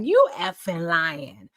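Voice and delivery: slow, drawn-out "you effing lying"; slow disbelief. Retold or imitated. Telling the man she doesn't believe him, slow and incredulous. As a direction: stretch it out, not buying it. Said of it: "I was like".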